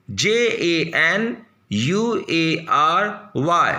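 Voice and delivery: usual speaking voice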